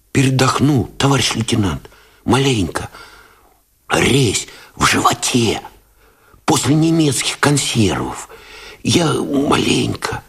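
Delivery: tired mumble. A narrator's reading. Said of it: "бормотал усталым голосом".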